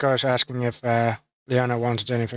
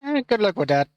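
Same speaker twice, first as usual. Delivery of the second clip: dismissive; 8 semitones higher than usual. Retold or imitated. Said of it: "gonna be like".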